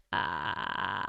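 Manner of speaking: fry opening into a sung ah; vocal fry into note. Shown as a demonstration. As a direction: start in low crackly fry, then open to a tone. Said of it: "take that vocal fry and transition into a note".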